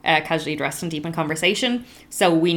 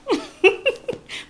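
laugh. Real, not acted. Laughing at elbows remark.

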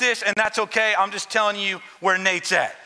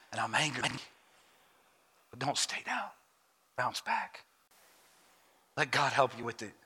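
quiet altar appeal; 9 semitones lower, 7 dB quieter than usual. Acted. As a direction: draw them in gently, heart to heart.

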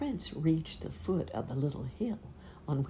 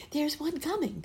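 high tree voice; 11 semitones higher than usual. Acted. Trees spot a suitor.

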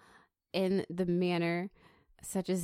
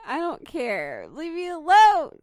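exasperated outburst; 8 semitones higher, 11 dB louder than usual. Pretend. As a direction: build to a high, loud yell. Cornered host snaps back at teasing.

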